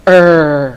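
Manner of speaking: low, held "Er" buzzing in the throat; sustained voiced "Er," demonstrated for learners; voiced R sound. Shown as a demonstration. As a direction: hold "Er" long and low so the neck vibrates. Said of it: "nó là âm voiced".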